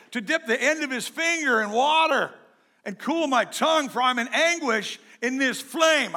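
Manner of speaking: high, loud plea from the flames; pleading cry. Acted. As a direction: cry out begging for mercy, pitch up. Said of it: "And he called out".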